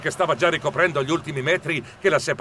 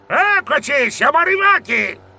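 loud, high called-out arrival; sailor's announcement; 12 semitones higher, 5 dB louder than usual. A narrator's reading. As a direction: call it out over the boat. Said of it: "il marinaio al timone annunciò". (annuncio gridato da marinaio)

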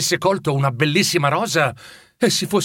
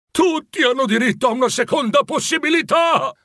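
desperate exclamation; 12 semitones higher than usual. A narrator's reading. Scrooge crying out to the ghost in despair. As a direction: throw it out at the height of desperation. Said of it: "esclamò Scrooge al culmine della disperazione".

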